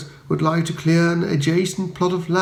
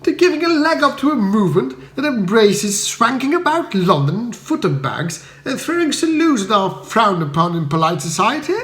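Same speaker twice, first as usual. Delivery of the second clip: Bertie scolding his valet in dismay; a toff in high-pitched outrage; stern indignation; 8 semitones higher than usual. A narrator's reading. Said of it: "I inquired sternly".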